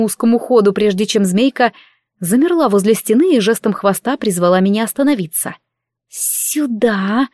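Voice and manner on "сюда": softer hissing snake call; hissing serpent dragon. Acted. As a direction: hiss the word like a serpent. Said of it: "прошипела Альгиз".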